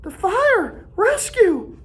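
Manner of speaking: high urgent Piggy voice; Piggy's urgent plea. A narrator's reading. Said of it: "Piggy whispered".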